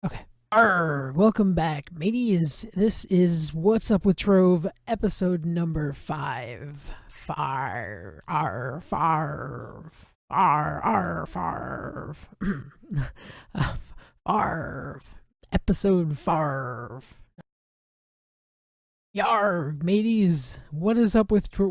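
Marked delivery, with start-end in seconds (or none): 0.5-6.9 louder, slower pirate intro. Pretend. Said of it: "Pirate voices". 7.1-12.2 pirate voice. Pretend. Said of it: "Pirate voices". 14.2-17.0 slow pirate drawl on farv. Pretend. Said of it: "Pirate voices". 19.2-21.7 higher, louder pirate call. Pretend. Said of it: "Pirate voices".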